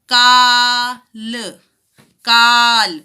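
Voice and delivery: काल with a very drawn out, loud aa; stretched aa in काल. Shown as a demonstration. Teacher to children, काल with the aa stretched out. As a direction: pull the aa in काल long. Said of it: "का का जो स्वर है थोड़ा सा खिंच के आएगा".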